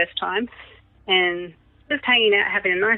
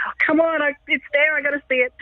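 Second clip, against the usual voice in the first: eager frustration; 8 semitones higher than usual. Retold or imitated. Mum frustrated can't see.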